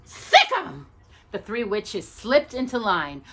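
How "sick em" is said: high, loud shrieking witch; shrill witch cry. A narrator's reading. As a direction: egg them on, witch shriek. Witch urging sisters.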